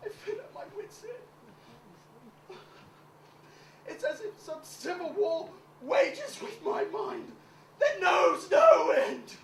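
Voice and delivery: slowed, swelling to a loud wail; milk the despair; hammed-up anguish; melodramatic grief.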